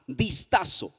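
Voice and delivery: usual speaking voice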